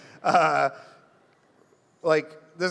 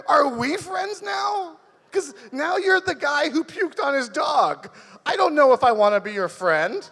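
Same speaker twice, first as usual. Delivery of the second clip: incredulous disbelief; 8 semitones higher than usual. Retold or imitated. Reacting to dog-puking neighbour.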